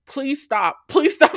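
hammed-up begging, high and rising; mock desperate pleading. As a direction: beg the screen to stop, pitch climbing. Re-enacting pleading at the screen.

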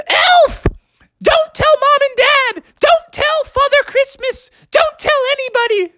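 very high-pitched panicked begging; frantic pleading. Acted. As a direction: squeal it like a desperate little frog. Frog pleads with the elf.

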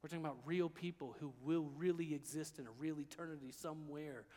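low, soft, sober sermon voice; hushed preaching. Real, not acted. Preacher reminding listeners these are real people, hushed preaching. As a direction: bring it down to quiet gravity.

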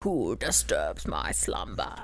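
deep, slow, ancient voice; grinding stone statue. Pretend. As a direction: rumble it low like an awakened stone guardian. Mock awakened monster voice.

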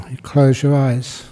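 calm meditation guidance with long pauses; guided meditation. Acted. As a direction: guide them unhurriedly into stillness, pausing between phrases.

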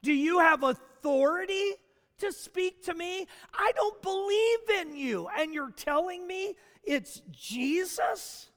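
high, incredulous demand; skeptical challenge. Pretend. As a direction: challenge the voice in disbelief. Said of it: "would your first question is".

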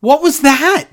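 high, loud baffled what was that. Real, not acted. Cohost reacting to a strange taunt, baffled and loud. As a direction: sound truly thrown by it.

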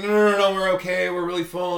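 high, loud, hurried politeness; hammed-up eager guest declining; polite refusal. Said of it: "They're like".

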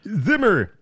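ZIMMER belted high and loud; sudden loud, high-pitched shout; exclaimed name. Real, not acted. Exclaims composer's name after saying it.

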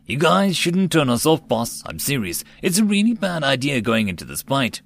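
plain reading voice

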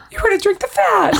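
very high, quick mock-moved voice; retold touched sadness. Rachel realizes he would have done it, mock sad. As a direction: imitate someone squeakily overcome. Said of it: "She's all sad".

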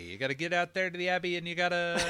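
fast, loud auctioneer patter. Retold or imitated. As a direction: rattle it off like an auction caller. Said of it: "It's like an auctioneer".

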